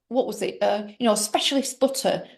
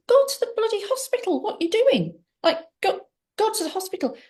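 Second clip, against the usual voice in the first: urgent son; 10 semitones higher, faster than usual. Retold or imitated. Son urging her to hospital.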